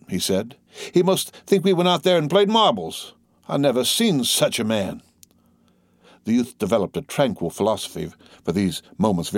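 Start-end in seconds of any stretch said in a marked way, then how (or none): 0.9-5.0 high-pitched grumbling complaint; aggrieved. A narrator's reading. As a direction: voice a sore grievance. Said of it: "with a grievance in his eye".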